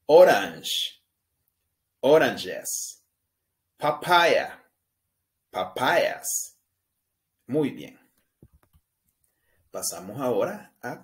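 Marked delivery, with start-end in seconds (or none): 0.0-6.6 louder, higher English "Orange, oranges", "Papaya, papayas"; model pronunciation. Shown as a demonstration. Teacher leading repeat-after-me drill. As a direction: say "oranges" as /oɾanʃes/, loud and clear. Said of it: "Repita después de mí cada una".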